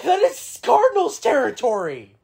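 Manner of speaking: loud, slow outraged protest. Real, not acted. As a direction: protest it loudly and slowly. Outraged at rival team's night.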